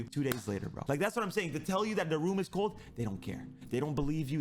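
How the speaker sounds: usual speaking voice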